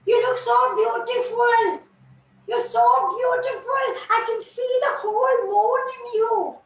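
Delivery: high, loud, delighted calling; excited call. Acted. Jasmine eagerly calling the dewdrop over, delighted. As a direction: call out joyfully to draw them close.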